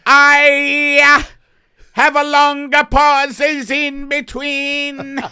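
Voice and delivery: high, slow alien voice with long pauses; loud, halting alien in Italian accent. Pretend. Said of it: "Italian alien voice".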